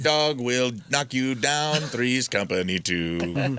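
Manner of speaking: put-on slow, lightly accented neighbor voice; mock Spanish accent. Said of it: "Yeah, the accent in the".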